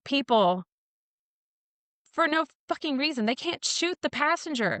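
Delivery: retold angry protest. Angry woman retelling her protest to her lawyer dad, indignant. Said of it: "I was so angry".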